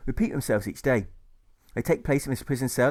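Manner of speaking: ordinary delivery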